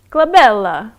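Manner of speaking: glabella said in a hammed-up Italian accent, rising at the end; a playful mock Italian glabella. Shown as a demonstration. Streamer does Italian accent on request. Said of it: "An Italian accent".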